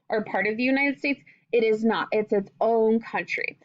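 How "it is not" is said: ordinary talk, nothing marked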